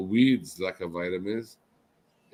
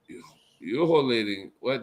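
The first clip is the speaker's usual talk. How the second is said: ordinary talk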